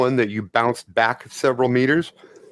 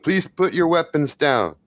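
higher-pitched anxious character voice; pleading stranger; 5 semitones higher than usual. Acted. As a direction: plead with them, insistent and repetitive. Stranger pleads with armed players.

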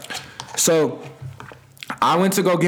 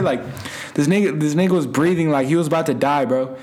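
usual speaking voice

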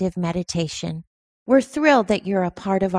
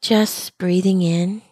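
calm, unhurried meditation guide, long pauses between phrases; measured mindfulness-teacher delivery with lingering silences; guided meditation. Acted. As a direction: guide listeners gently into their breathing, leaving space after each phrase. Leads a breathing meditation.

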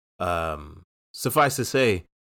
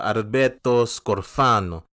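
slow, savoured mock Italian name; Italian accent; 2 semitones higher, 4 dB louder, slower than usual. Shown as a demonstration. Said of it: "that is my Italian accent".

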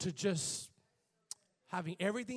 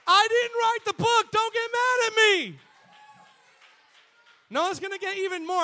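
loud exclaim; 12 semitones higher, 9 dB louder than usual. Real, not acted. Preacher defending the harsh verse.

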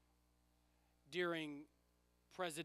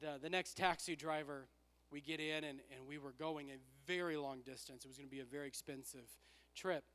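usual speaking voice

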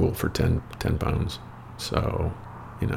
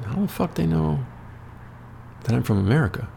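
baffled; 10 semitones higher, 4 dB quieter, faster than usual. Retold or imitated. Stunned question to Brendan.

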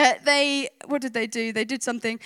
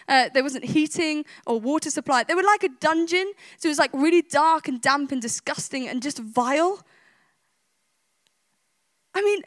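ordinary delivery

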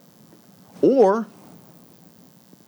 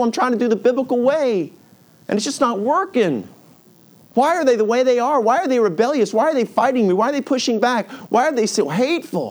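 high, pleading frustration, questions piling up; exasperated parent; 8 semitones higher than usual. Acted. Parent complaining about rebellious kids, exasperated. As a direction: wail in mock exasperation, pitched up.

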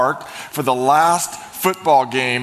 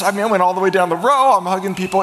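much higher and louder, fast; jubilant excitement; 7 semitones higher, 5 dB louder than usual. Real, not acted. Pastor reliving the stadium win for the congregation, thrilled. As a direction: relive the celebration with full joy.

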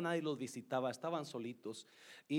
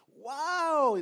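surprised wow; 11 semitones higher, 10 dB louder than usual. Real, not acted. Hearing her children were found.

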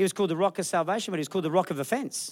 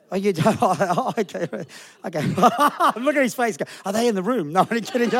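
louder, laughing while teasing; 5 dB louder than usual. Real, not acted. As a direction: tease him through real laughter. Teasing a staff member, laughing.

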